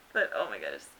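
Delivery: ordinary talk, nothing marked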